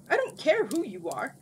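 loud, high, cross retort. A narrator's reading. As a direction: snap back crossly. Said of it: "replied crossly".

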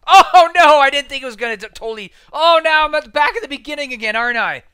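high, loud outburst of disbelief; exasperated high-pitched yelling; loud dismay. Real, not acted. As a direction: cry out at losing your progress. Sent back to the level start.

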